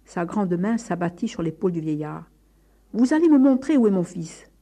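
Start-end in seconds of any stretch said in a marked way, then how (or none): 2.9-4.5 loud, high angry order; father seething with rage; furious. A narrator's reading. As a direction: threaten with raised voice. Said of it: "Le père écumait de fureur".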